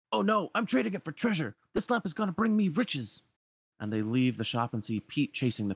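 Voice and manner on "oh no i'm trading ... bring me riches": high-pitched protesting merchant; greedy peddler. Acted. Peddler refusing to give Pete the lamp, protesting.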